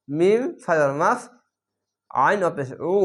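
ordinary delivery

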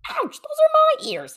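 high pained holler. A narrator's reading. Hare yelping as Bear grabs his ears. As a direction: yelp in protest. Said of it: "hollered Hare".

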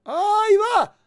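high, loud game cry; excited shout. Real, not acted. Player reacting to the move in the game, excited shout. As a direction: yell it out as it happens. Calling out during play.

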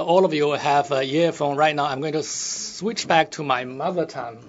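normal talking voice

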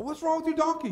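put-on high puzzled old man; old man asking. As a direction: ask her in a high, baffled voice. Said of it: "He's like".

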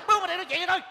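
high-pitched angry summons; gọi gắt hàng xóm. Acted. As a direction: order him over, voice pitched right up. Summoning the annoying neighbour over. (gắt gỏng gọi sang, giọng cao vút)

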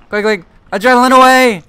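high, loud and hurried; urgent. Real, not acted. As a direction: urge it out fast. Urgent in chase.

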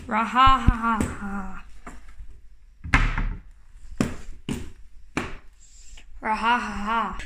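low villain chuckle; evil monster laugh. Acted. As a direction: laugh like a gloating beast. Giant laughs.

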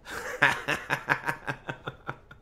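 short rattling burst of laughter; real laugh. Real, not acted. Streamer still amused by the game, laughing. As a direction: laugh in quick, even pulses.

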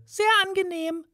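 high, tiny mouse voice; small mouse greeting; squeaky mouse. A narrator's reading. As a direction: pipe it like a little mouse.

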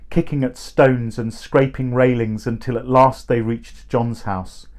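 ordinary delivery, nothing marked

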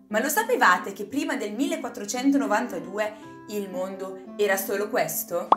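plain reading voice

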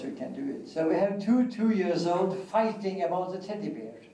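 ordinary talk, nothing marked

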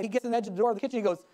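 normal talking voice

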